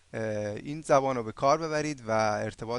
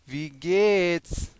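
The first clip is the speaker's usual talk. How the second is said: "وی گیتس" stretched into long syllables; very slow model; 3 semitones higher, 3 dB louder, much slower than usual. Shown as a demonstration. Teacher introduces German how-are-you, "وی گیتس" very slow and drawn out. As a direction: draw out both vowels of "وی گیتس" for learners.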